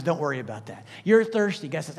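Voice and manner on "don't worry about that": lowered, softer dismissive God voice; casual God. Retold or imitated. Preacher voicing God telling the hungry not to worry, lowered and soft. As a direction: lightly voice God shrugging it off.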